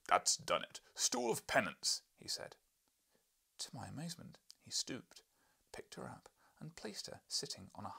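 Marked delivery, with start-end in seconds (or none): 0.1-2.0 loud, slow pronouncement; husband's boom. Acted. Husband to wife, a loud slow boom. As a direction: boom out the verdict.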